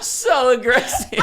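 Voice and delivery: slow, high words squeezed out through laughter; helpless laughter. Real, not acted. Reacting to a friend's joking insult, laughing helplessly. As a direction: laugh so hard the words barely come out. Cracking up after the pun.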